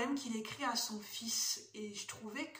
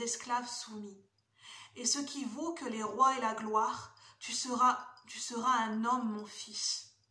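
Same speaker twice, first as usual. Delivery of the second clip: measured verse reading with a pause after each phrase; deliberate, slightly lifted poetry voice; poem recitation; 2 semitones higher, 5 dB louder than usual. Acted. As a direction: recite a father's poem to a son, key words leaned on. Reads Kipling poem aloud.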